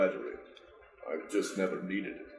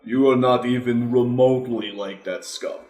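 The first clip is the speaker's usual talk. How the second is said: stern; 3 semitones higher, 7 dB louder than usual. Acted. Proud character scolds child.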